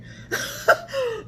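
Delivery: high hearty laugh. Acted. Narrator laughs with elephants.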